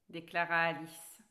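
ordinary delivery

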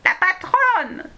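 high, slow goblin squeak. Acted. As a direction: squeal it like a scared little goblin. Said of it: "couina le plus laid des corrigans".

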